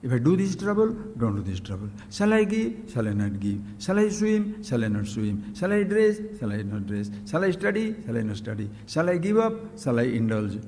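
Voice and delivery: Hamlet-style inner debate; two inner voices. Acted. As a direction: argue with yourself, back and forth. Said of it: "Hamletian human conflict".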